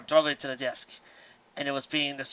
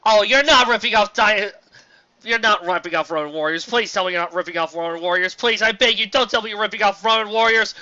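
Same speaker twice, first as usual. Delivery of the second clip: begging the author not to do it; exasperated pleading; 5 semitones higher, 8 dB louder than usual. Real, not acted. Reviewer begs the fanfic author, loud exasperated pleading.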